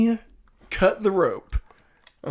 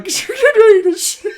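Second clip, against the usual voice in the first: high, fast, cackling threat; gleeful threat; 13 semitones higher, faster than usual. Acted. Lex taunts Clark about Phelan, gleeful and high. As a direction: savor scaring him, giggling.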